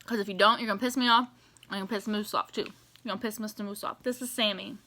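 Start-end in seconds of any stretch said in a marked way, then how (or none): 1.7-4.8 much softer, quick little aside. Real, not acted. Fan introducing her toy moose, softer and quick. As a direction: murmur it to the toy.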